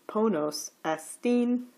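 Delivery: "ponos estine" slowly, up on "po", down on "nos", high on "-tine"; both accents retained. Shown as a demonstration. Teacher demonstrating the second rule, "estine" keeping its accent. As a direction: keep "estine" accented, its last syllable held high after "ponos". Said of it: "your pitch is up for po".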